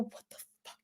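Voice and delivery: stunned whisper; whispered disbelief. Retold or imitated. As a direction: breathe it out in shock, no voice. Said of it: "I go".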